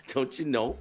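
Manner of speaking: exaggerated Minnesota mom accent. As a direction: draw it out in playful Minnesotan. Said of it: "just to get that accent".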